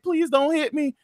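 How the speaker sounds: small, high pleading voice; scared child. Retold or imitated. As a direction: beg softly like a frightened kid. Voicing scared Alice.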